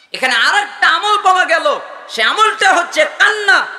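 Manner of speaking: high, loud proclamation of a lesson; full-force preaching. Real, not acted. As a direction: shout the point out to the congregation.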